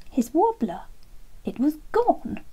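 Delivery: put-on surprised child discovering a lost tooth. Boy discovers tooth is gone.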